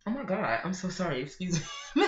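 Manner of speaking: flustered sorry after sneezing; startled apology. Real, not acted.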